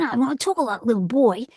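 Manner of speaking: put-on high, bright-eyed little boy. Shown as a demonstration. Voice actor demos her little boy voice. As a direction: voice a cheeky young boy. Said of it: "that's kind of little boy voice that I often do".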